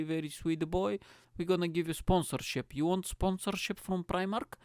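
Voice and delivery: hammed-up Eastern European sales pitch; thick comic Eastern European accent, lilting upward. Primark rep offering the podcaster sponsorship, mock Eastern European accent. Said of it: "Primark is gonna have an Eastern European accent".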